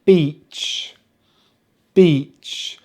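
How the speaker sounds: "beach" with long, falling EE; long EE demo. Shown as a demonstration. Teacher modelling a favourite example, long EE in "beach". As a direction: hold the EE in "beach", lips spread. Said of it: "Finally, my favourite".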